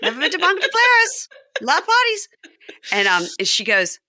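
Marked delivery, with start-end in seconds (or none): 0.0-2.3 squeaky falsetto, far higher and a bit louder; high-pitched John impression. Host mimics John in a squeaky falsetto. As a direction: squeak it like a giddy guest. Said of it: "more high-pitched".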